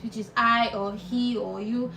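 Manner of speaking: usual speaking voice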